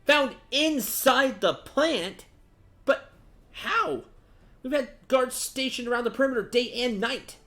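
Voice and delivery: high, loud, disbelieving officer; alarmed officer. Acted. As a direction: cry out in shocked disbelief, pitched right up. Voices alarmed character on hearing news.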